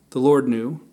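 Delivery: usual speaking voice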